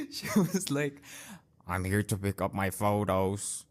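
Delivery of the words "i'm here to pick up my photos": low curt imitation of a rude lady; mock a rude customer flatly. Imitating the rude lady demanding her photos at the counter.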